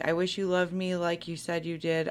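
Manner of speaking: normal talking voice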